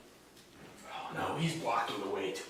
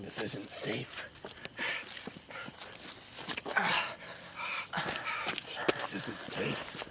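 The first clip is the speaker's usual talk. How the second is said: quiet urgent; 7 dB quieter than usual. Acted. Quiet worried warning.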